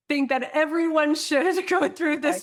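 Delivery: high, amused delivery breaking into laughs; laughing. Real, not acted. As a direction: speak through laughter, high and loud. Laughing while recommending the program.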